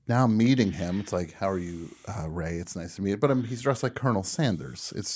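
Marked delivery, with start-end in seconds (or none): 1.3-3.2 understated greeting. Retold or imitated. Retelling his awkward first greeting.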